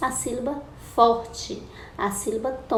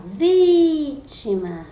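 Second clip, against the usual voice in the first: VÍ in "Vítima" held long, high and loud; slow, loud, drawn-out VÍ in "Vítima"; calling out the word; 4 semitones higher, 5 dB louder, much slower than usual. Shown as a demonstration. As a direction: call out "Vítima", stretching VÍ before it falls. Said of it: "A sílaba que você chama mais forte".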